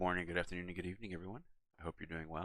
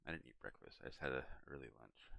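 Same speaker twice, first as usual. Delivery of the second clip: "early lunch" muttered low and fast; quiet aside; 6 semitones lower, 12 dB quieter than usual. Real, not acted. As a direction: throw away "I just had an early lunch" quickly and softly. Quiet aside repeating the point.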